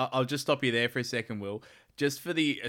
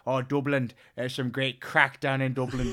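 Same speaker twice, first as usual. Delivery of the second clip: put-on Belfast lady's Northern Irish accent, louder; imitated Belfast accent; 2 semitones higher, 6 dB louder than usual. Belfast lady tipping off tourists, imitated Northern Irish accent.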